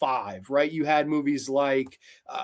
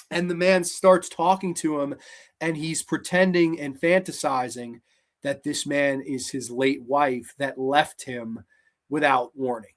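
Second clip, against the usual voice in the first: normal talking voice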